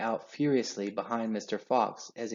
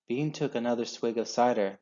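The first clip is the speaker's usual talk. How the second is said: plain reading voice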